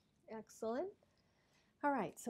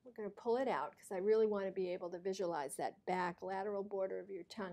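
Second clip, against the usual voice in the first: ordinary delivery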